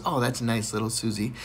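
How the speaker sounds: low soft praise; gentle teacher. Retold or imitated. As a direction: pat her on the head.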